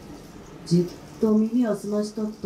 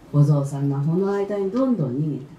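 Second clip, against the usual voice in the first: plain reading voice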